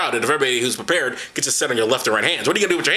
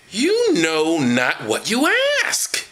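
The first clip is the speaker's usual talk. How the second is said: slow solemn; 2 semitones higher, much slower than usual. Acted. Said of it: "solemn Jesus line".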